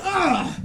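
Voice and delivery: high, thin yelp of pain; imitated pained yelp. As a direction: imitate a sudden cry of pain. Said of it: "He was like".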